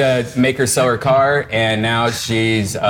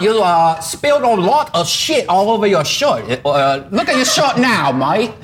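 put-on British accent; 7 semitones higher than usual. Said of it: "posh British accent go around the room".

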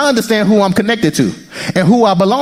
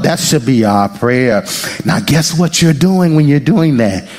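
usual speaking voice

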